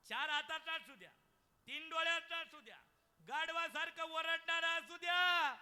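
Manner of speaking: loud, high sermon cry; full-force preaching. Acted. Kirtankar telling the birth story, loud preaching. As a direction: preach it out to the back row. Mother's love proclaimed.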